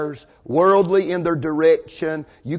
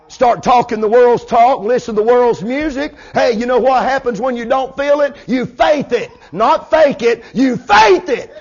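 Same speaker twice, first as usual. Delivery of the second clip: loud, high revival preacher shout; full-force preaching; 6 semitones higher, 6 dB louder than usual. Real, not acted. Preacher shouting to the congregation to live by faith, full-force preaching. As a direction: drive every phrase home at the top of the voice.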